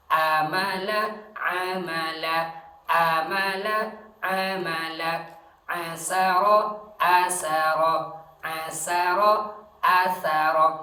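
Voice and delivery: Amala and asara chanted loud, paired takes contrasting A and Ain; loud pronunciation drill. Shown as a demonstration. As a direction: drill each word loudly for the class. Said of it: "A, ini A dengan Ain". (contoh pelafalan keras berulang)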